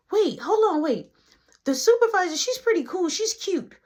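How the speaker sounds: a hint of a high, flustered guy; lightly voiced befuddled friend; confused coworker. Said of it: "So he was confused".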